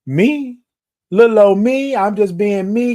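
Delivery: put-on high, loud "little old me?" disbelief; mock surprised modesty. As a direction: play it up like you can't believe it's about you. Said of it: "And I was saying".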